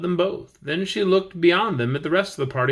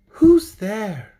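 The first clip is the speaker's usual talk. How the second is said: put-on girl's voice; 6 semitones higher, 6 dB louder than usual. Girl answers knock at door.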